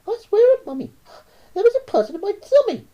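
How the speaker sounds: put-on high, loud child's voice; pleading to be believed; insistent little boy. A narrator's reading. Boy swears to Mummy about the tummy person, high.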